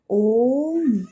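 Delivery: ओम with the ओ held three times as long; ओम drawn out slowly, vowel long; prolonged om. Shown as a demonstration. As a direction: stretch the ओ in ओम, then close on म. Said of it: "पढ़ते समय इसे थोड़ा लंबा स्वर दिया".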